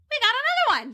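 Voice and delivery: high, thrilled we got another one; delighted exclaim. Retold or imitated. Drunk sisters cheering at a new Australian, high and thrilled.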